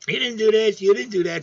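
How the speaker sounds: imitating kids yelling. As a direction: parrot the kids nagging at dad. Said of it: "They're screaming at me".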